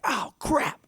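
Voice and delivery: very high mock yelp; imitating a startled yelp, very high; yelp of pain. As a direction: squeak it out like you just got shocked. Retold shock from electricity.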